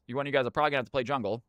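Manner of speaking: normal talking voice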